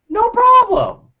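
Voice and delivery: very high, slow, stretched mock cheer; squeaky slow no-problem; high cartoon eager yes. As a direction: sing it out like a cartoon helper. His own exaggerated eager reply.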